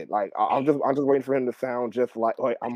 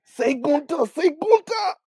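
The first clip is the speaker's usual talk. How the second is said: high mock demand; 4 dB louder, slower than usual.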